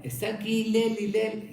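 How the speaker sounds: high, loud call for Hillel from outside the door. Retold or imitated. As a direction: shout his name to bring him out of the house. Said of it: "vuelve a gritar, a llamar".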